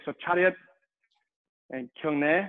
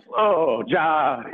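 loud, lower-pitched drill call; called-out command; 6 semitones lower, 5 dB louder than usual. Real, not acted. Instructor slowing the class after a sprint, called-out command. Calling a change of drill pace.

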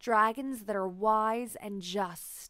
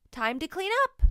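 teacher calling out; 9 semitones higher, 6 dB louder, faster than usual. A narrator's reading. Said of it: "says Miss Mary".

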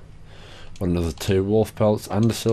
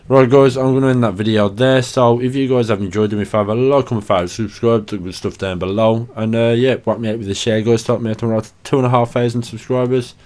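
louder, upbeat sign-off voice; youtube outro; 3 semitones higher, 5 dB louder than usual. Acted. Youtuber signing off to his subscribers, loud upbeat outro. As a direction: wrap it up and pump the audience.

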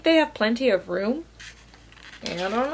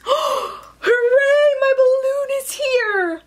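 high, jubilant storybook squeal; excited cheer; 12 semitones higher, slower than usual. Acted. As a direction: cheer with delight, pitched way up. Fox's balloon has arrived.

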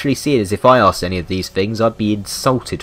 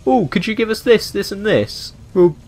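mock polite AI; 7 semitones higher than usual.

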